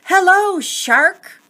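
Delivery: loud high shout across the water. Acted. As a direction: yell it out, ringing the bell. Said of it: "clang his bell and shout".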